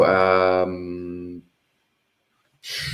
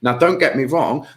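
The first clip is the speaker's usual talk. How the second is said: normal talking voice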